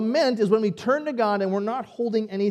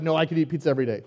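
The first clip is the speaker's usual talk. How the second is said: rushed eager retort; 2 semitones lower, 3 dB louder, much faster than usual. Retold or imitated. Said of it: "Some of you are like".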